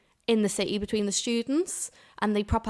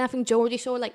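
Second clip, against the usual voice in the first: ordinary talk, nothing marked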